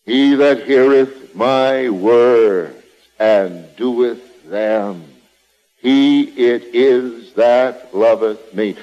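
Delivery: drawn-out, weighty reading of the verse; slow scripture reading. Acted. Preacher repeating the verse to drive it home, slow and weighty. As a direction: let each word of the verse land, slowly.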